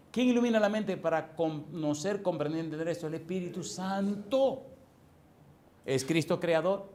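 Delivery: loud, high challenge to the congregation; full-force preaching. Real, not acted. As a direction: fire rhetorical questions at the crowd.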